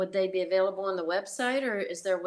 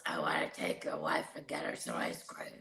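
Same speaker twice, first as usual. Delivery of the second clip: much lower, quieter gruff imitation of Ron; impression of Ron; 11 dB quieter than usual. [name] imitating Ron offering the wife ice cream, low and quiet. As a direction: drop low and soft to mimic Ron's voice. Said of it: "you do such a good impression".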